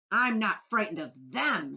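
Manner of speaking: loud defiant shout. Acted. Centipede shouts defiance at the cloud men. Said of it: "shouted the centipede".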